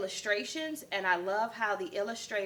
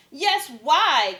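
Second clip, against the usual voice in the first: loud higher call; 5 semitones higher, 9 dB louder than usual. Acted. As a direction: call out from below. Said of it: "called the Dingoes".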